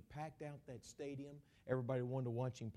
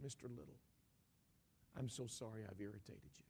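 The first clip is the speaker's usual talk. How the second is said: hushed, contrite retelling; quiet apology; 5 semitones lower, 10 dB quieter than usual. Retold or imitated. Preacher apologizing to an irritated father, hushed and contrite. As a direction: say sorry softly to calm him.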